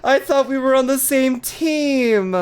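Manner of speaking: high, slow playful wail; mock betrayed. Streamer to supposed teammate, mock betrayed. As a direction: drag out "team" in mock hurt.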